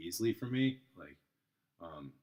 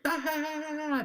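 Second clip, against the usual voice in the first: very high hammed-up chuckle; high mock laugh; 6 dB louder than usual. The tourist laughing before his reading, in a high mock chuckle. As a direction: play a carefree high laugh.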